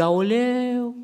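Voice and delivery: a lament rising in pitch; body crying out. Retold or imitated. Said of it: "El strigă".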